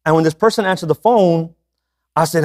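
ordinary talk, nothing marked

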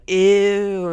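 loud, high drawn-out "ew"; exaggerated squeal of revulsion; mock disgust. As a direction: play someone grossed out. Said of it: "But you're like".

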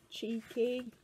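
plain reading voice

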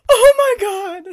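high loud thrilled cry; excited shriek. Real, not acted. Thrilled cry on recognizing the old scammer. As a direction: shriek with delight.

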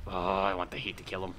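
ordinary delivery

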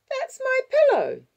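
put-on very high, loud little-girl cry; outraged child. Six-year-old whose pillow was thrown away, outraged child. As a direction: protest at full pitch as a small child.

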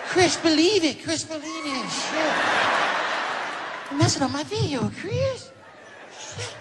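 very high, soft falsetto scolding. Retold or imitated. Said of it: "[name] was getting mad".

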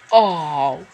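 loud, sudden "oh" at the screen; loud dismay. Real, not acted. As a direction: blurt it out, caught off guard. Reacts to a play.

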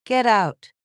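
a contained narrated yell. A narrator's reading. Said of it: "Grace started yelling".

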